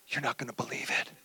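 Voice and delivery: hushed aside to wife. Retold or imitated. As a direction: lean in and tell her in a hush.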